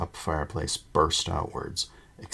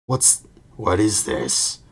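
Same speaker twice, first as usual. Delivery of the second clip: slow, louder angry growl; 2 semitones higher, 7 dB louder, slower than usual. A narrator's reading. Uncle Vernon confronting Harry, slow and angry growl. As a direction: growl it out, slowly, as a threat. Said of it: "growled Uncle Vernon".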